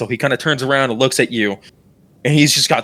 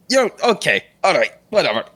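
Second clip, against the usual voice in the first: higher-pitched, mildly unsettled dismissal; uncertain backing off; 6 semitones higher, faster than usual. Acted. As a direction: brush it off, a touch rattled. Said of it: "a little uncertain".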